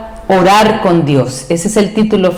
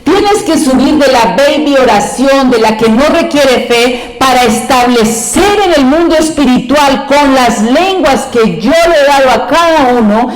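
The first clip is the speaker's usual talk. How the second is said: lightly voiced divine urging, slowing at the end; a gentle nudge to rise higher; God exhorting the church; 5 semitones higher than usual. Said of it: "Él está diciéndole a la iglesia". (Dios exhortando a la iglesia, con suavidad y sin prisa)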